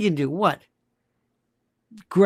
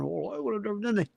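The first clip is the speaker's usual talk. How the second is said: mock reply; 10 dB quieter, faster than usual.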